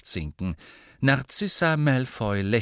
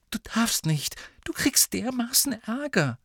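high, hissed warning; whispering to stop a friend; urgent whisper; 12 semitones higher than usual. A narrator's reading. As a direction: plead in a whisper. Hermine urging Harry to stop.

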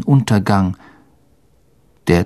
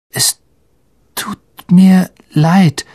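halting, trembling apology, higher and louder; 8 semitones higher, 5 dB louder, slower than usual. Acted. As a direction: stammer it out slowly, shaking. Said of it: "sagte er zitternd".

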